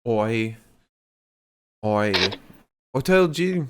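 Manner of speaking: rough stab at an Irish accent. Shown as a demonstration. Showing the co-host how to do an Irish accent, Irish accent. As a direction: try out a few Irish sounds. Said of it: "How do you do an Irish accent?".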